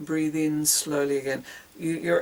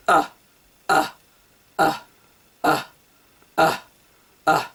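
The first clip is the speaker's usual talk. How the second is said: short, sharp grunts with gaps between; grunting; 7 dB louder than usual. Shown as a demonstration. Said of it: "for your diaphragm is grunting".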